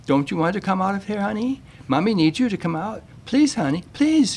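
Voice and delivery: put-on high, pleading mother begging her toddler; pleading mom. Mother begging her son to climb out, high pleading. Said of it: "she begged".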